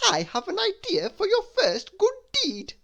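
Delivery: Mister Tickle sharing a plan; eager scheming voice. Acted.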